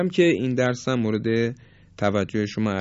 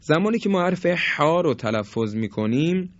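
normal talking voice

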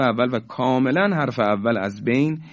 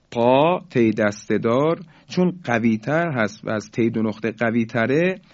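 normal talking voice

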